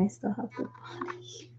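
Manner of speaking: soft whispered aside; whispering. Real, not acted. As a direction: whisper it to yourself. Whispered asides while working.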